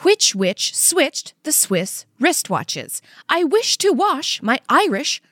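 slow tongue twister attempt. Shown as a demonstration. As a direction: stumble on the last word. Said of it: "I can't do that one".

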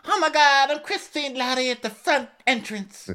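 very high squealing mock panic; hammed-up caricature, starting loud; Jennifer. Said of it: "She gets that, like".

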